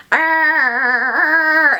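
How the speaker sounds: long, silly creature groan; monster noise. Retold or imitated. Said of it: "make these noise".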